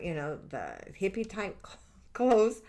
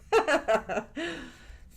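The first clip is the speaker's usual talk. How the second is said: a bright burst of real laughter, pitched up; 8 semitones higher, 5 dB louder than usual. Real, not acted. Laughing with viewers about Dutton Ranch. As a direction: laugh out loud at the shared guilty pleasure.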